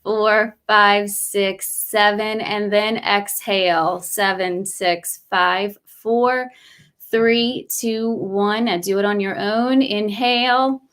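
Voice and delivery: slow, measured breath count with drawn-out cues; guided breathing meditation. Real, not acted. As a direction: guide them through each breath, unhurried.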